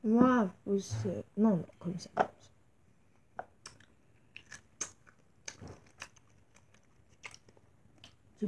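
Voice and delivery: chewing while talking; talking between bites of fruit; mouth full. Real, not acted. Seen 0: Bites strawberry just before, strawberry held to mouth during talk.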